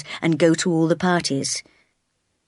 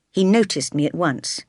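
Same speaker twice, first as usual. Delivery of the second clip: usual speaking voice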